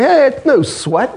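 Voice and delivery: high-pitched, tossed-off brag; mock nonchalance. Preacher showing how Paul did not act, mock nonchalant. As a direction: play at being unbothered, pitched well up. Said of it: "he's not acting like".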